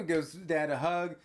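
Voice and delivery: plain reading voice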